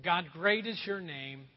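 slow, hushed prayer voice; preacher opening the closing prayer. Acted. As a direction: lead the closing prayer reverently.

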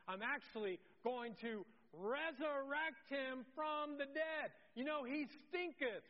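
high, emphatic preacher's proclamation; rising, punched-out declaration; bold announcement. Acted. Jesus's acted bold announcement.

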